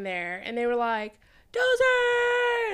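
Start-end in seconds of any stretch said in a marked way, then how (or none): 1.5-2.7 high, loud shout of her name; excited fan's shout. Retold or imitated. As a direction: call her name out in delight. Fans calling out her name.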